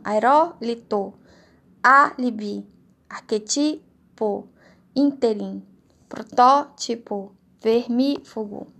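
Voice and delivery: "Aerólito, álibi, ínterim" slowly, punching the third-last syllable; tônica na antepenúltima sílaba. Shown as a demonstration. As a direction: hit the antepenultimate syllable of "protótipo, vermífugo". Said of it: "que é o antepenúltimo". ("Aerólito, álibi, vermífugo" devagar, com força na antepenúltima sílaba)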